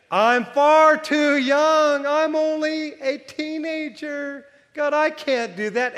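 put-on high, slow, pleading teenage voice; whiny young Jeremiah. Jeremiah whining to God that he's too young. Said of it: "what Jeremiah began to say".

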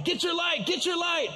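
high-pitched urgent holler; retold yelling. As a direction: yell the order in mock panic. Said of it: "and I'm yelling".

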